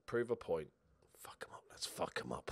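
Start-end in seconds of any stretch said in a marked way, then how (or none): 1.2-2.5 low unvoiced whisper to himself; a whispered dare before kickoff; hushed psych-up. Real, not acted. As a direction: hiss the rallying words under the breath. Hushed pre-match psych-up.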